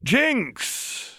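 high, drawn-out scolding cry; appalled, pitch leaping up; alarmed protest. A narrator's reading. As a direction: call the name to rein someone in. Chuck cries out to stop Jinx.

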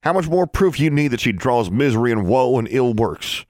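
indignant accusation. A narrator's reading. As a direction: denounce them with rising indignation.

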